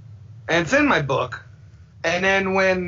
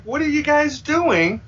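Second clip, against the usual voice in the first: incredulous; 11 semitones higher than usual. Retold or imitated. Quoting his mocking reaction.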